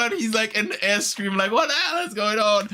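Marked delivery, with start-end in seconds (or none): 1.5-2.7 high-pitched bewildered wail; mock panic. Boy snatched and carried off, high mock-panicked cry. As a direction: cry out as if being whisked away, pitched up.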